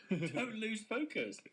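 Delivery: high, slow, amused repeat of a slogan; laughing mockery. Gym member mocking an email from his gym, laughing incredulity. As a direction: draw it out high, through laughter.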